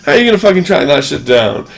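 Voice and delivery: ordinary talk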